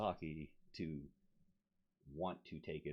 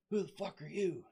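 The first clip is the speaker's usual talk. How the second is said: high, loud mock recruiter; gruff recruiter; 13 semitones higher, 4 dB louder than usual. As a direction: snap at him like a startled recruiter. Recruiter barks at stranger.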